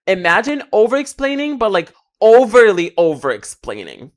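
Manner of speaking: slow, stretched overly over-explaining; drawn-out over-explaining. Shown as a demonstration. To viewers, showing how overdoing feels, slow stretched stress. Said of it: "See how that feels".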